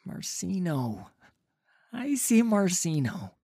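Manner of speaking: pained, strangled answer; choked up. Acted. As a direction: force the name out through a tight throat. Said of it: "the words choking his throat".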